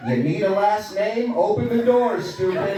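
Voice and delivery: high-pitched imitation of a bossy kid; lightly voiced impatient child, high; mock impatient. Daughter's retold knock-knock punchline.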